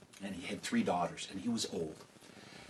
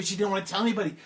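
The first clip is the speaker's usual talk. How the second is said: plain reading voice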